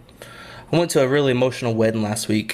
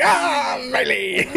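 imitated pirate; 14 semitones higher, much slower than usual. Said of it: "I was like".

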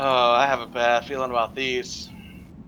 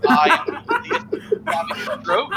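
laughing; 11 semitones higher, much slower than usual. Real, not acted. Joking that his character's face sags.

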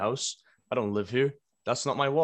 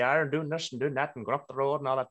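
a higher, broad Donegal Irish lilt, put on for effect; thick Donegal accent; 4 semitones higher than usual. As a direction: ham up a thick Donegal accent as a joke example. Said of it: "the thickest Donegal accent ever".

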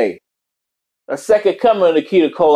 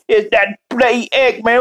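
higher put-on character voice; Robotnik Cat sixty-nine; 5 semitones higher than usual. Streamer insisting he is not Eggman, in high character voice. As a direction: protest in a raised, cartoonish British-slang voice. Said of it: "Robotnik Cat sixty-nine".

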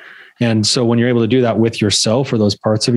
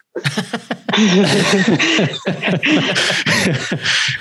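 long high burst of laughter; real laughter at the punchline; host laughing at the story; 9 semitones higher than usual. Real, not acted. Laughing at the damn trigger.

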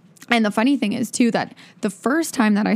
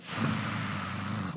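long weary sigh; 13 semitones lower, 14 dB quieter than usual. Retold or imitated. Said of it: "I'm like".

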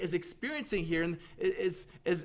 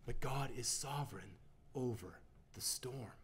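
unvoiced, much softer and lower; whispered; 5 semitones lower, 12 dB quieter than usual. Real, not acted. Preacher hushing to a whisper for the congregation, low and unvoiced. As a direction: whisper it as a solemn truth. Quiet climax of sermon point.

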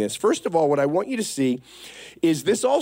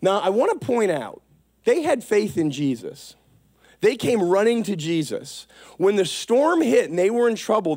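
ordinary talk

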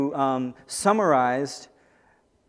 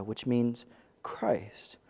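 whispered aside; 3 semitones lower, 8 dB quieter, much slower than usual. A narrator's reading. Aside while reading scripture.

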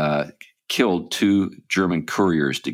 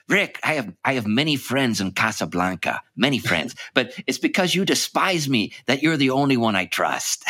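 Peter Lorre voice; 6 semitones higher than usual. Retold or imitated. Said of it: "then Peter Lorre, uh, says".

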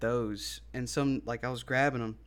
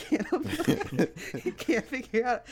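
high words squeezed through laughter; real laughing; 11 semitones higher, 3 dB louder than usual. Real, not acted. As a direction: talk while cracking up. Cracks up at listener's joke.

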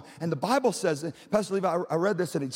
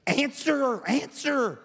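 put-on high, drawn-out country accent; country wife; 7 semitones higher, much slower than usual. Wife orders pastor to reply, in a high country accent. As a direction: imitate an insistent wife with a country twang. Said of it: "she is country. And, and Jen goes".

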